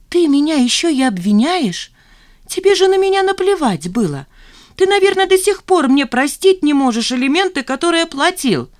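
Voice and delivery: high, loud indignant tirade; outraged accusation. A narrator's reading. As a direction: hurl accusations at your father. Жанна обвиняет отца в ссоре. (возмущённая обвинительная тирада)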